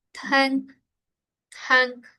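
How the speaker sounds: "tank" ending in ng through the nose; nasal ng ending. Shown as a demonstration. Teacher showing the nasal ending. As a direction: let the air out the nose at the end. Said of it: "esa nk, sale por la nariz".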